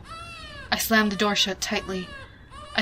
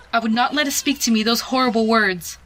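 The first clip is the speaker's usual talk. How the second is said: ordinary delivery, nothing marked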